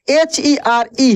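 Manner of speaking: letters spelled out slowly, one by one; teacher dictating letters slowly; slow spelling. Shown as a demonstration. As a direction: spell it out for the class, unhurried. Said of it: "teacher spells word letter by letter".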